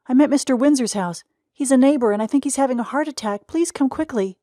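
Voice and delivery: high, loud, rushed plea; panicked call for an ambulance; urgent frightened boy. Acted. Frightened boy calls for help.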